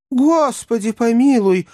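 high-pitched cry of alarm; dismayed exclamation. A narrator's reading. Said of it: "воскликнул несчастный мистер Пиквик". (испуганный высокий возглас)

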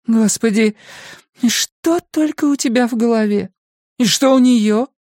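high-pitched despairing questions; exasperated woman. A narrator's reading. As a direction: plead high for some sense from him.

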